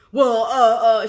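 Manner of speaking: flustered stammer. Retold or imitated. Provider challenged by the coder, flustered stammer. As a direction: fumble for words when caught out.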